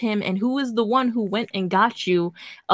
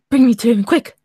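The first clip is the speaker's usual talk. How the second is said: urgent; 3 semitones higher, 6 dB louder than usual. Acted. Father's urgent order.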